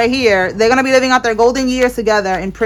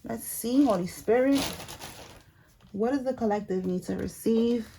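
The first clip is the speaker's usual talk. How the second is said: soft, quiet call to the Holy Spirit; hushed prayer; 10 dB quieter than usual. Acted. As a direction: murmur the question to the spirit, softer.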